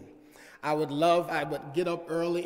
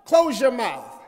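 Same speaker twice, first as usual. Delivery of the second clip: put-on loud barked "Boy" much higher; uncle's shout; 8 semitones higher, 10 dB louder, slower than usual. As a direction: yell at the nagging kid. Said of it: "turned around and said".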